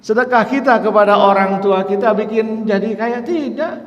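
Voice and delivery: loud, high rhetorical question; fiery sermon voice; preaching at full force. Acted. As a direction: hammer the question to the crowd.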